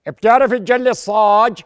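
slow, loud question; gruff question. Acted. Blacksmith asking about work.

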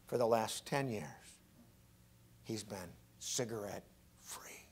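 dropping to a soft whisper; hushed whisper. Acted. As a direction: let it fall to a whisper.

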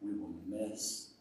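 slow, low and hushed; quiet, grave closing appeal; solemn exhortation. Real, not acted. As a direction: let each word land with long pauses.

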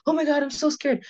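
high-pitched pretend panic; mock fright. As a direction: squeal it like a fake scared girl. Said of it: "I'm not like".

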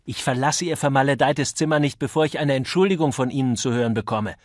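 loud, high, indignant outburst; angry shouting. A narrator's reading. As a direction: shout it at your opponent, pressing fast. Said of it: "schrie Mister Copley".

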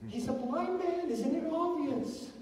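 very high, incredulous protest; mock incredulity. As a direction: play baffled at an obvious question, pitched high. Mock disbelief at the question.

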